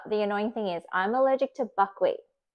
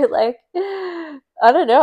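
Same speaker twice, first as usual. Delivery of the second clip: high, slow, giggly hesitation; laughing; 8 semitones higher, 7 dB louder, much slower than usual. Real, not acted. Laughing off the nurse's cosplay idea, high and slow. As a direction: laugh it off while shrugging.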